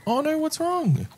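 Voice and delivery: high squeaky voice for a fretting little creature; mock-worried squeak; cutesy worried creature. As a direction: play the tiny creature asking what's wrong, very high. Voicing the creature's worry.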